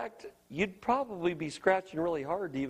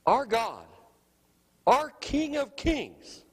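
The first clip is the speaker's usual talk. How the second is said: ordinary delivery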